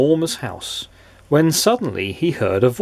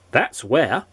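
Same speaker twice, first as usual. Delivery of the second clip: ordinary delivery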